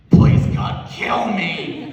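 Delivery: sick in bed, begging loudly; hammed-up loud groan to God; mock anguished plea. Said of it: "just like".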